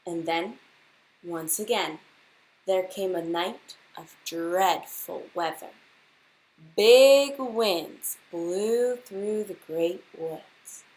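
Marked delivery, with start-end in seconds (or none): none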